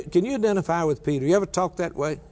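ordinary talk, nothing marked

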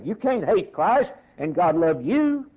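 ordinary talk, nothing marked

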